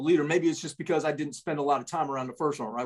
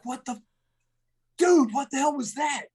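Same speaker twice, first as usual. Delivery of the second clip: a sudden loud yell, pitched up high; startled shout; 9 semitones higher, 4 dB louder than usual. Retold or imitated. Yelling at Corbin after he passed out, a startled high shout. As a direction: shout in shock at what just happened. Friend just passed out at pinning.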